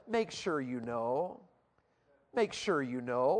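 slow, soft announcement of the title; solemn title. Acted. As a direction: let the title sink in gently.

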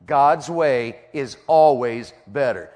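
word by word, drawn out; slow emphatic repetition. Real, not acted. Preacher drilling the truth into the congregation, slow and emphatic. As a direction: stretch out "God's way is always better" very slowly. Same line slowed for emphasis.

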